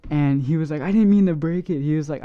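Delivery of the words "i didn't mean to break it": higher, louder imitated excuse; retold protest. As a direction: mimic a friend defending himself. Friend defends himself after breaking glass.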